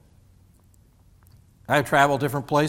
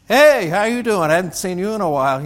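louder, higher calling out hello; friendly hello; 6 semitones higher, 6 dB louder than usual. Retold or imitated. Greeting a neighbor at the dump, cheerful call. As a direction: hail an old friend across the dump.

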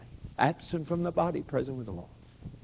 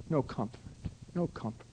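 hushed lament; 6 semitones lower, 11 dB quieter than usual. Real, not acted. Grieving preacher repeats.

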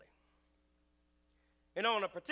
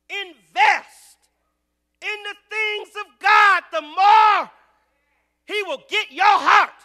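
loud, high fervent preaching; preaching at full force; 13 semitones higher, 20 dB louder, slower than usual. Acted. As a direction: preach it out with fire.

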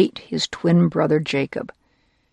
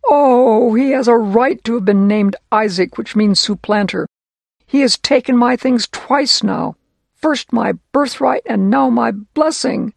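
upset complaint; 5 semitones higher, 5 dB louder than usual. Acted. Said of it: "And Esau said".

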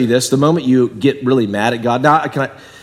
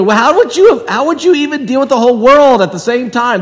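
pitched higher and louder, amazed disbelief; incredulous question; 9 semitones higher, 4 dB louder than usual. Retold or imitated. Preacher voicing a listener who is amazed at God, higher and louder, incredulous. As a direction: ask it like you can't believe anyone could do that. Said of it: "But then you go".

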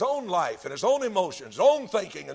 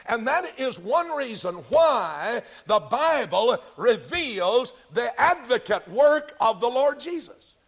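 emphatic preaching; 4 semitones higher, 4 dB louder than usual. Real, not acted. Preacher proclaiming war in heaven to his congregation, emphatic preaching.

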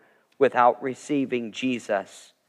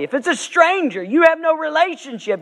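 much higher, driving the point home; emphatic high preaching; 14 semitones higher than usual. Real, not acted. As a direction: raise the voice to hammer the contrast.

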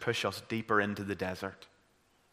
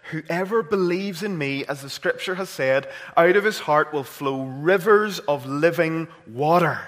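scripture quote, measured; 4 semitones higher, 5 dB louder than usual. Acted. Preacher quoting John to the congregation, earnest. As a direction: read the verse with steady emphasis. Quoting Jesus, first reading.

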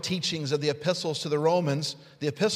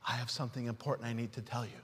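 softer, fast, hushed words; quiet wife; 7 semitones lower, 10 dB quieter, much faster than usual. Retold or imitated. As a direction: mouth it quietly like a concerned wife. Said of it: "she's saying".

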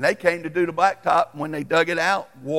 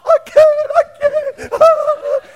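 very high, loud crying refusal; hysterical wail; 11 dB louder, much slower than usual. Retold or imitated. Wife refusing to finish cutting, high hysterical wail. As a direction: imitate the wife sobbing she can't. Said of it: "She's, like, shaking".

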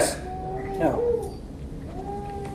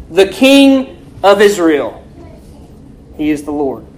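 normal talking voice

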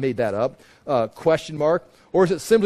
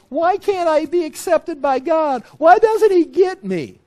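high, loud wail of complaint; mock weeping; 12 semitones higher, 6 dB louder than usual. Rejected worshipper complaining about God, mock weeping. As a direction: wail it out in hammed-up self-pity. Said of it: "he's weeping. It's like".

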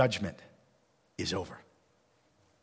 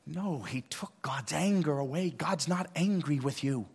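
ordinary talk, nothing marked

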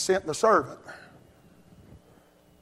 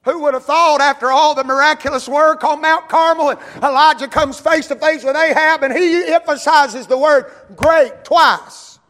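high, loud preacher's cry, voicing Elijah; full-force preaching; 8 semitones higher, 8 dB louder than usual. Sermon climbs to full force.

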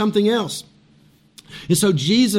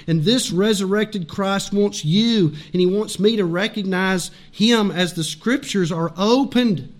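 normal talking voice

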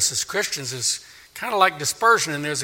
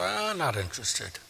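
dismissive shrug, softly thrown away; indifferent mumbled brush-off; shrugging; 10 dB quieter than usual. Acted. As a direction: brush it off with a soft verbal shrug. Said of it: "they just shrug their shoulder".

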